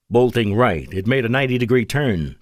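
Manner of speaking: usual speaking voice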